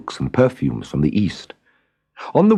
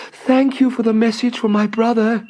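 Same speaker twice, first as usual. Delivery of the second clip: high, ringing call of a joyful bird; a little bird calling back happily as she flies off; 14 semitones higher than usual. A narrator's reading. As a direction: call out to him from the sky, overjoyed. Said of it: "she called".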